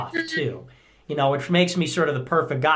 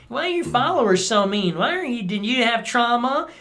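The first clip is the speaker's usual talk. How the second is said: mocking critics in a higher voice; needling mockery; 8 semitones higher than usual. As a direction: needle him like a snide critic. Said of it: "it's always this needling".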